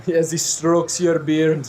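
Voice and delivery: put-on slow accented strongman petting an animal; strong man. Strong man stroking the tiger's beard, slow doting accent. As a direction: draw it out in the strong man's accent. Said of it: "he'll go by the tiger and go".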